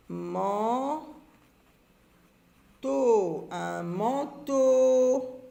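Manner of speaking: manteau stretched into long man and long teau; syllable by syllable. Shown as a demonstration. Teacher dictating manteau while writing, syllables drawn out. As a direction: draw out both syllables of "manteau".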